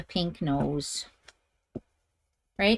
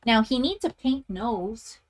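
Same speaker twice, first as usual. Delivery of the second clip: ordinary talk, nothing marked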